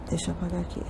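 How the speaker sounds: very soft, low aside; talking to oneself. Real, not acted. Teacher erasing the screen, murmured aside. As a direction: murmur it as you busy yourself.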